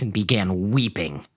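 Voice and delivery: ordinary delivery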